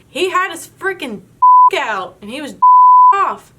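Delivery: shocked blurting. Retold or imitated. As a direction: spill the shocking news in disbelief. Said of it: "And I finally say".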